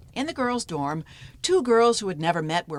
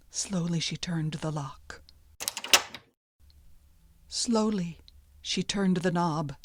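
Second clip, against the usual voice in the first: hushed; 4 dB quieter than usual. A narrator's reading. Tense slow opening of the door.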